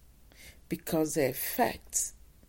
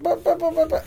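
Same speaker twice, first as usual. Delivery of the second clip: high, loud stammering buts; a kid sputtering to talk back to his father; whiny sputter; 11 semitones higher, 10 dB louder, faster than usual. Retold or imitated. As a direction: sputter in protest like a frantic kid. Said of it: "until you stop whining".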